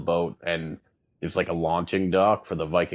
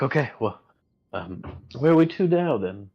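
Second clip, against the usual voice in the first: lightly voiced Edmund; 6 semitones higher than usual. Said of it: "He's like".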